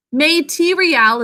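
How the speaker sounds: "Material" said as may-tee-ree-al, every vowel full; "Material" with a clear MAY and AL; spelling pronunciation. Shown as a demonstration. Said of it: "the way we think to spell it".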